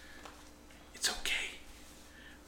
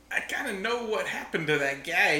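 ordinary talk, nothing marked

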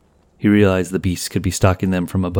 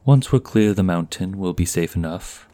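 usual speaking voice

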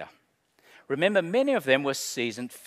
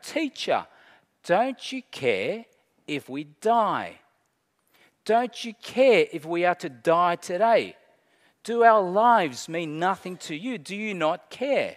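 raised, pleading protest; desperate cry; 4 semitones higher, 6 dB louder than usual. Acted. Disciples accusing Jesus of not caring, crying out desperately. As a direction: cry out higher, pressing each question home. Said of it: "they also cried out".

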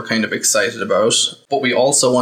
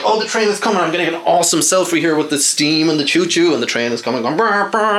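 much higher excited mock tourist voice; mocking selfie-taker; 6 semitones higher, faster than usual. Mocking a selfie-hunting fan for listeners. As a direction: ham up a silly trespasser thrilled by the train. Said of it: "standing on the train like".

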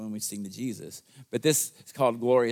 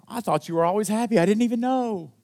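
surprised father; 10 semitones higher, 5 dB louder than usual. Acted. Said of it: "I can see the dad thinking".

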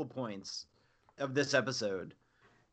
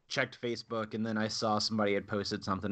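normal talking voice

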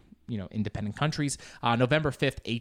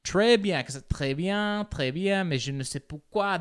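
high-pitched, overeager French accent; showy learner's French, straining to sound native; trying-too-hard French accent; 8 semitones higher, 3 dB louder than usual. Shown as a demonstration. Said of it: "Then I just sound like I'm trying".

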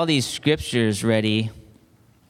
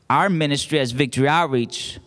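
usual speaking voice

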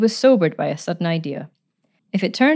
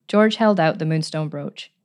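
ordinary delivery, nothing marked

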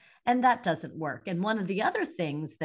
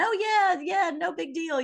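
high, breezy mock shrug, much higher and louder; brushing it off; 11 semitones higher, 3 dB louder than usual. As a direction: toss it away like it's nothing. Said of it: "I used to hide from all that".

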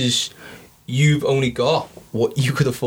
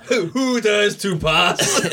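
stagey fake archaic declaiming; 7 semitones higher, much slower than usual. Mock knight challenging at door.

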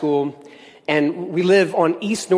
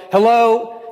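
raised, higher mock call; louder, pitched-up calling voice; retold yell; 5 semitones higher, 5 dB louder than usual. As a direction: imitate hollering out to a stranger. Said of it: "so they yell".

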